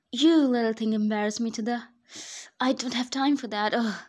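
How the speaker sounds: loud, exasperated scolding of an object; annoyed shout at a forgotten file; frustrated outburst. Acted. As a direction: vent at the thing that ruined the day.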